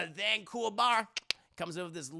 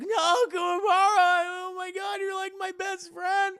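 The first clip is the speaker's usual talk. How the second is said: very high, loud, hammed-up wailing; mock crying; 13 semitones higher, 8 dB louder than usual. Best friend mourning the fallen hero, hammed-up high sobbing wail. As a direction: bawl it out in an exaggerated squeal of grief. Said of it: "starts fucking crying".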